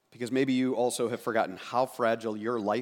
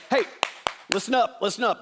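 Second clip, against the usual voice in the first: teacher calling out; 7 semitones higher than usual. Retold or imitated. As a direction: call the kids to attention like a teacher. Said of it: "imagine a school teacher out on the playground going".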